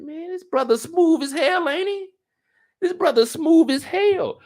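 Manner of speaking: very high, squeaky-pitched astonishment; amazed. Acted. Commentator marvels at the smooth brother, amazed. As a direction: marvel in a mock falsetto.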